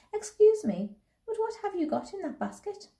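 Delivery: put-on very high little-girl voice; inquisitive child. A narrator's reading. Girl asking a stranger about her basket, high and inquisitive. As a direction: pipe up politely, pitched right up. Anna questions the Swiss lady.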